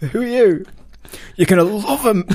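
high, loud put-on voice; hammed-up squeaky sketch voice; comic improvised character. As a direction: play it way up high, with a laugh in it. Improvised scene of English arriving.

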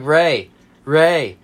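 insistent loud calling of a name; calling out loudly. Retold or imitated. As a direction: keep yelling his name to get his attention. Trying to get drunk friend's attention.

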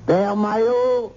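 slow high call. Acted. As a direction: call him across the hall. Calls louder second time.

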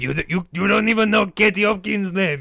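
high-pitched, hurried comeback; indignant retort. Acted. Interviewee snaps back at his partner, indignant and high.

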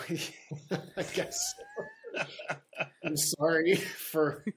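slow, amused mock apology through laughter; laughing. Real, not acted. Answering the host's arm candy joke, laughing.

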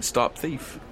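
held-back mock yell; retold mild yell. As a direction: report the cry without shouting. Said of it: "looking around and yelling".

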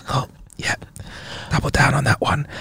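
hushed, soft whispering gambler; whispered poker. Acted. Gambler answering the silent poker table, whispering. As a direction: keep the bets under your breath. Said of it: "like whispering poker".

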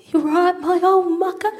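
high, tentative mock Cockney greeting; squeaky imitation Cockney; put-on Cockney. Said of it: "They put on a Cockney accent".

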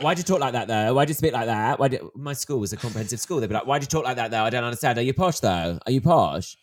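loud mocking imitation of schoolkids; loud retold playground interrogation; nosy schoolmates. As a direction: fire loud, challenging questions like a classmate. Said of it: "people be like".

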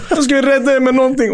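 high-pitched, amused incredulity; laughing disbelief. Retold or imitated. Frozen substitute told to save the team, laughing incredulously. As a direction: squeal it through laughter, mock incredulous. Mocks coach's expectation, laughing.

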